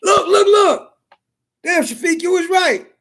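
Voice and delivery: high, excited disbelief at the bugs; amazed outburst. Retold or imitated. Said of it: "He said".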